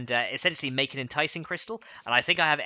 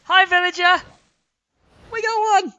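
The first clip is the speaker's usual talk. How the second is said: high squeal; 11 dB louder than usual. Real, not acted. Squealing greeting to the villager.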